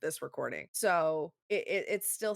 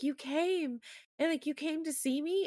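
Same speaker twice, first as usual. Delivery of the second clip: put-on much higher, wondering girl voice; dying girl's surprise; 10 semitones higher than usual. Dying girl to her hospital visitor, touched surprise. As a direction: marvel that he showed up. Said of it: "she's like".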